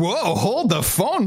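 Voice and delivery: slow, high whoa of fake shock; mock amazement. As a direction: play up the surprise. Mock amazement at real-time grades.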